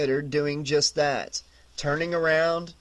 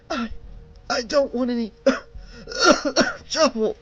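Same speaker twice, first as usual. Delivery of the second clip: higher breathless plea with a cough; winded plea; 8 semitones higher than usual. A narrator's reading. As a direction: gasp it out, coughing. Winded clerk pleading, coughing.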